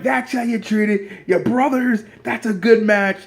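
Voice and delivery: high-pitched congratulatory call; celebrating cheer. Acted. Fans hailing two brothers after a match, elated. Voices wrestlers celebrating together.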